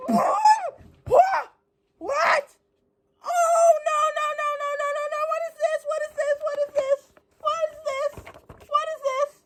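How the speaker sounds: high, squeaky fangirl squeal; overwhelmed fan repeating herself in a high squeal; squealing disbelief. Real, not acted. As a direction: shriek in giddy disbelief. Fan shrieks over the photos.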